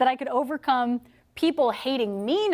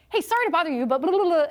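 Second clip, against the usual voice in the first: higher, bright doorstep opener; mock the eager door-knocking pitch; perky sorry-to-bother-you salesman; chipper salesperson; 5 semitones higher, faster than usual. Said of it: "heard a salesperson go".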